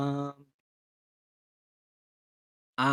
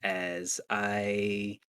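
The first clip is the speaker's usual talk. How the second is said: normal talking voice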